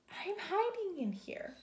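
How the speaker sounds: tiny high bird squeak; Jeremy's little canary voice. Acted. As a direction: pipe it up like a wee bird. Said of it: "a little voice spoke".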